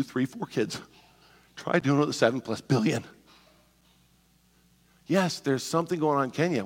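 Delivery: ordinary talk, nothing marked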